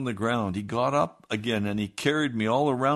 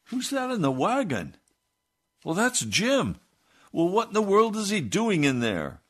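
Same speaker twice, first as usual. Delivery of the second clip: high, loud calling across the road; 11 semitones higher, 5 dB louder than usual. Retold or imitated. Farmer with sheep calling out to John, high and loud. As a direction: call from a distance. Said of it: "he called out to John".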